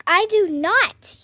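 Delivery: put-on emphatic childish protest; insistent denial. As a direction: plant your feet and deny it. Denies then repeats louder.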